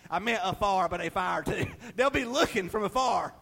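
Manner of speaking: ordinary delivery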